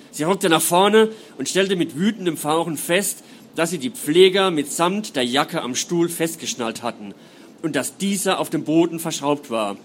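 measured, dramatic reading voice; story narration. A narrator's reading. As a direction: read it like an audiobook. Author reads novel prologue aloud.

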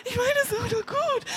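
high, soft pleading whimper; desperate whimper. A narrator's reading. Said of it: "wimmert er verzweifelt". (verzweifeltes Wimmern)

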